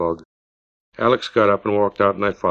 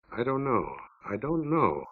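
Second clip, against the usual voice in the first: troubled quiet; 9 dB quieter, slower than usual. Acted.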